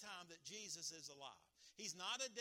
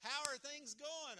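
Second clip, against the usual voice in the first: upbeat greeting; 7 semitones higher, 5 dB louder, slower than usual. Retold or imitated. Preacher greets a churchgoer.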